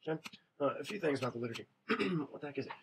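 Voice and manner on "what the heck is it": ordinary talk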